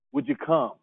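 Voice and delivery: normal talking voice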